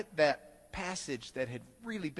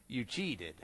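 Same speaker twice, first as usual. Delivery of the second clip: professor voice; 8 semitones lower, 8 dB quieter than usual. Retold or imitated. Professor accuses him.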